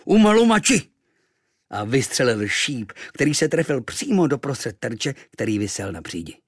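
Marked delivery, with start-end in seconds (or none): 0.0-0.8 high, loud war cry; loud native war cry; battle cry. A narrator's reading. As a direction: shout it as the arrow flies. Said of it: "vykřikl".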